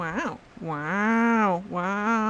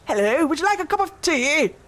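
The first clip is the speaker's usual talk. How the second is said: higher, louder mock posh English accent; 7 semitones higher, 4 dB louder than usual. Teasing what counts as English, in a mock posh English accent. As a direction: ham up a prim English host offering tea.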